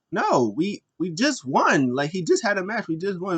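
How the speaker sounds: high-pitched, put-out objection; mock indignant protest. Imitating Woods answering Cedric's challenge, mock indignant.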